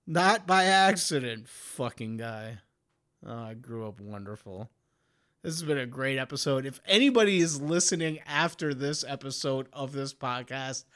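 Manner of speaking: normal talking voice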